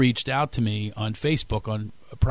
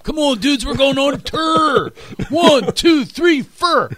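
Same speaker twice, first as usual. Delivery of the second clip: high, loud shouted count-in with 'er' vowels; rally the band in a hammed-up high voice; mock rock frontman; 13 semitones higher, 6 dB louder, slower than usual. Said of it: "[name] doesn't go on tour".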